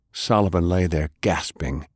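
ordinary delivery

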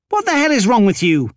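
sharp, fast, high angry outburst; snapped. Acted. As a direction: snap at him in disbelief. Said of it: "Thera snapped".

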